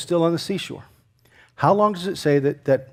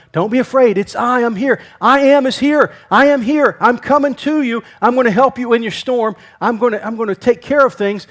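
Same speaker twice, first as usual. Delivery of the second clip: high, raised proclamation of help; emphatic reassurance; 6 semitones higher, 4 dB louder than usual. Acted. Jesus promising help in the storm, loud emphatic reassurance. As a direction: call out urgently to someone in a storm. Voicing Jesus calling to the believer.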